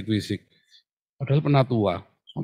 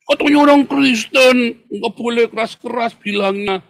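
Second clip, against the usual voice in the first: mock shouted complaint; 9 semitones higher, 5 dB louder than usual. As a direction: raise the pitch and draw out the objection. Shouted mock objection at fish market.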